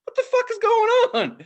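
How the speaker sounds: very high, loud mock bewilderment; baffled outburst. Comic onstage baffled by the sandwich eater, high mock outburst. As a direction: squeal it like a flabbergasted stand-up.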